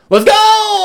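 high, loud rallying yell; excited kid shout. Retold or imitated. Kid yelling to his friends to run, excited shout. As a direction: whoop the gang into the chase.

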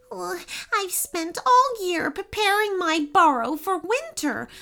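high, plaintive little animal voice; acted tearful chipmunk. A narrator's reading. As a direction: lament a lost burrow, close to sobbing. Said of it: "sobbed the chipmunk".

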